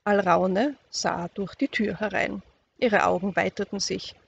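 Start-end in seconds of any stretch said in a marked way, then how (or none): none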